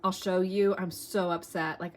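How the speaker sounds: usual speaking voice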